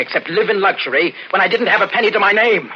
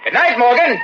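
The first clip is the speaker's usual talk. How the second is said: calling out; 9 semitones higher, 4 dB louder than usual. Acted. Calling to dead uncle upstairs.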